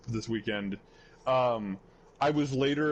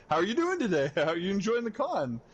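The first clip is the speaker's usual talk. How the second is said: higher, perky host voice with a laugh; bubbly small talk, pitched up; cheery VTuber voice; 7 semitones higher, faster than usual. Retold or imitated. VTuber chatting up confused cosplayer, perky and high.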